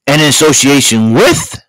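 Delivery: booming, high announcer sign-off; loud hyped-up announcer outro. Acted. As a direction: boom it out like a TV show announcer. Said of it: "Mister Announcer, please take us home".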